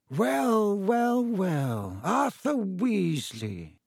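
slow, high, sneering villain; Lucius Malfoy. A narrator's reading. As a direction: draw it out with lofty contempt. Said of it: "sneering in just the same way".

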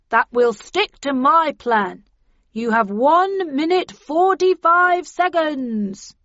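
loud, slow call through a megaphone; bullhorn voice. A narrator's reading. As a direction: boom it out over a bullhorn. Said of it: "replied the voice on the bullhorn".

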